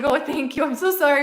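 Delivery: ordinary talk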